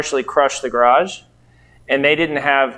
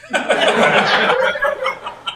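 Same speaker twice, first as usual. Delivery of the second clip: breathy, high burst of laughter. Real, not acted. Laughing at the open-book dealer test, high and airy. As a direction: laugh at the absurdly easy test.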